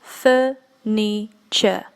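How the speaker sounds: very slow, drawn-out furniture; slow model word. Shown as a demonstration. Teacher modelling furniture very slowly for the class. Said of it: "Let's begin with furniture".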